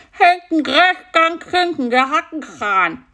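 high, mumbled with an open mouth; mouth wide open. Acted. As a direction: talk while the dentist holds your mouth open. Mouth held open at dentist.

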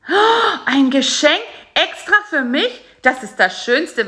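high, loud delighted gasp; can barely believe the gift; overjoyed stammer. A narrator's reading. Spider Karl-Heinz, handed a gift, gasps with high delight. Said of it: "stammelte Karl-Heinz".

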